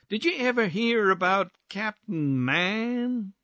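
leisurely, stretched-out gossip; drawled syllables. Acted. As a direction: draw out the stressed vowels lazily. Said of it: "drawling out his syllables".

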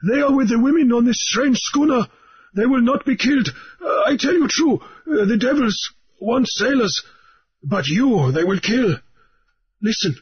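high, fearful urgency; high, anxious pleading; apprehensive islander. A narrator's reading. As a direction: plead with rising dread. Mauriri in agony of apprehension.

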